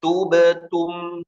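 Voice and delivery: Tubetum diucapkan pelan dengan bibir dimonyongkan lalu kembali; tubetum demo. Shown as a demonstration. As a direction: show the lips pushing out then back on Tubetum. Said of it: "Itu begini". (Tubetum dengan bibir maju lalu balik)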